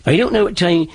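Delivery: put-on old settlers' East Anglia accent; East Anglian accent. Shown as a demonstration. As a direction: demonstrate a Norfolk and Suffolk accent. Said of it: "they sounded like this. They'd say".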